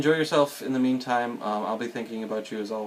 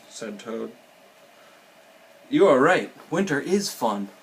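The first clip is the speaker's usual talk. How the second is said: animated storybook reading, louder for the dialogue; reading to children; 2 semitones higher, 5 dB louder than usual. A narrator's reading. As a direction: read it lively, lifting the characters' lines.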